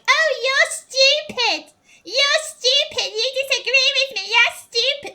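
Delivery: high, loud, mocking childish yell; childish insult tantrum. Mocking Sera's childish insults, a high loud bratty yell. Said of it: "to be like".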